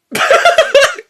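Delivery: shrill goblin cackle; high cackle. Acted.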